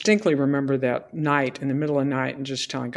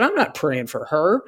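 laughing, high-pitched outrage; are you kidding, mock disbelief; half-laughing incredulous protest; indignant refusal; 8 semitones higher, 8 dB louder than usual. Retold refusal to God.

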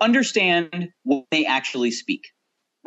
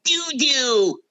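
helium voice; 8 semitones higher than usual. Acted. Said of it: "do Ta's voice as if you've sucked on helium".

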